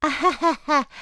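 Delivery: high nervous fake laugh. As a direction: laugh it off, thinking fast. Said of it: "had to think fast".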